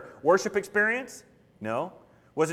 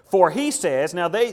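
usual speaking voice

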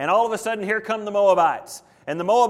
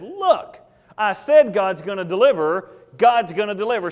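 scolding; 3 semitones higher, 4 dB louder than usual. Acted. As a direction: scold a doubter in a raised voice. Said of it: "Elisha scolds him and says".

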